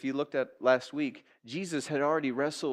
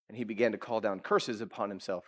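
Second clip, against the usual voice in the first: ordinary talk, nothing marked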